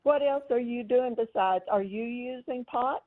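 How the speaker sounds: ordinary talk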